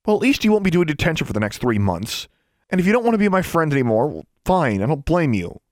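high, heated, wounded outburst; upset retort. Acted. Alexandra argues back at David about detention, upset retort. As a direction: throw it back at him, pitched high.

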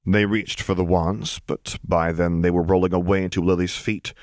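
plain reading voice

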